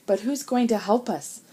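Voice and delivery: ordinary delivery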